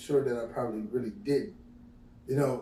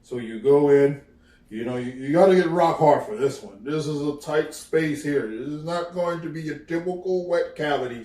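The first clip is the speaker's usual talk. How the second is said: mock parent lecture; 7 dB louder than usual. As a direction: lecture the son like a blunt father. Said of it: "Your parents is not gonna sit there and tell you".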